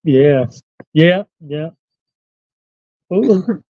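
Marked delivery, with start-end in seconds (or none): none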